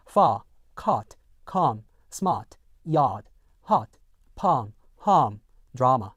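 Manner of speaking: FAR, SMART, YARD, HARM without an R sound; teacher's British model, R silent in far and harm; British standard pronunciation. Shown as a demonstration. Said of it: "영국 표준 영어에서는요".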